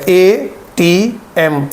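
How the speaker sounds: एटीएम said as separate letters, ए टी एम, slowly; spelled out letter by letter. Shown as a demonstration. Teacher showing students to read एटीएम as separate letters, slowly. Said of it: "उसको हमने अलहदा अलहदा पढ़ना है".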